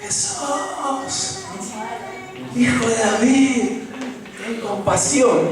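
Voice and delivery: high-pitched pleading cry, slowed down; the blind beggar calls out to Jesús, high and pleading; desperate plea. Acted. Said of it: "se pone a gritar".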